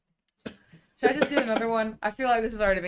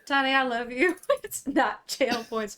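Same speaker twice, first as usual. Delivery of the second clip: laughing; 5 semitones higher, 3 dB louder, slower than usual. Real, not acted. GM laughing at a donation.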